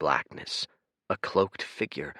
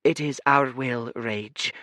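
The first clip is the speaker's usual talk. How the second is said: higher but gentle, calm and apologetic; soft kind voice; 5 semitones higher, 3 dB louder than usual. Acted. Kind blue twin apologizing to Fate, soft gentle voice. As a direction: soothe the guest. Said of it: "said the blue one simply".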